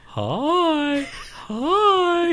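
imitating the guest walking in, very high; high creepy greeting. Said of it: "I'd be like".

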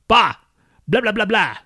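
high, mocking mom yell; yelling mom impression. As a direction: imitate a mom scolding in nonsense. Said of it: "do an impression of my dead mom".

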